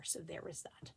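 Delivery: soft unvoiced whisper. Real, not acted. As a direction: murmur it as a sheepish aside. Whispered aside.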